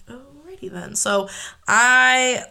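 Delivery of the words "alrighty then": breathless whisper, taken aback; whispered alrighty. Retold or imitated. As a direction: whisper it like you don't dare argue. Her stunned reply to boyfriend.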